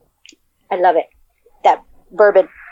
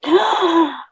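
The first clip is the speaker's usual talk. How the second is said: delighted gasp; 7 semitones higher than usual. Real, not acted. Hears of whiskey ice cubes.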